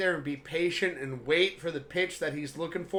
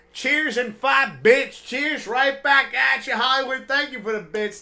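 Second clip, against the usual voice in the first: loud enthusiastic thanks; 5 semitones higher, 7 dB louder than usual. Real, not acted. Thanking a donor loudly.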